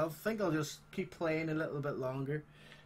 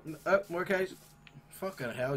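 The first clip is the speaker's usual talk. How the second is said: usual speaking voice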